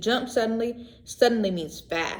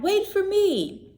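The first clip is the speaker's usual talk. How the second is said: high, slow calling duckling; little duckling hurrying; eager cry; 11 semitones higher, 3 dB louder, slower than usual. A narrator's reading. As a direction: call after the others. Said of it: "he cried".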